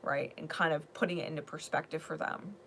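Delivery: ordinary delivery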